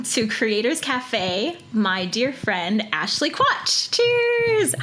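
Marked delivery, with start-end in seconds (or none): 3.3-4.7 bright high cheers, glass raised; cheery toast. Real, not acted. Host toasting her guest, high and cheery. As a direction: toast your friend with a lifted voice.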